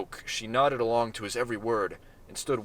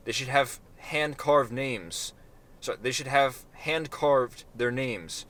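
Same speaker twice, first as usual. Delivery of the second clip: slow disdain; slower than usual. Acted.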